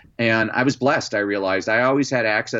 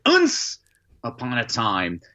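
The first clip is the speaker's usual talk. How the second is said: put-on little kid trying to impress; child showing off reading; slower than usual. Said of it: "And I say".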